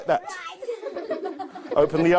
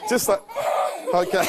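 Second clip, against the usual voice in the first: laughing; 3 semitones higher than usual. Real, not acted. Laughing with the helpers.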